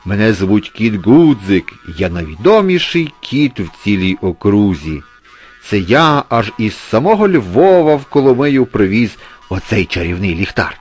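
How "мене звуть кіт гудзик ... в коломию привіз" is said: loud, raised ceremonial speech; proud exclaiming cat. A narrator's reading. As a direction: boast grandly as the town's famous cat. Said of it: "вигукнув Гудзик". (гучна урочиста промова хвалькуватого кота)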